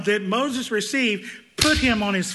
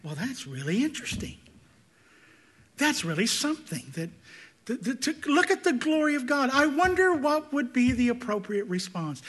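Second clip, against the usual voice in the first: mock detached musing; 5 semitones higher than usual. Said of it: "you say".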